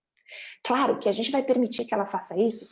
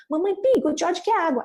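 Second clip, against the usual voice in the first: higher, louder little sing-out; child echoing cartoon line; 8 semitones higher, 4 dB louder than usual. Child keeps repeating a cartoon line to ask, childlike echo. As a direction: recite it like a cartoon-quoting kid. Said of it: "Ele vai continuar falando".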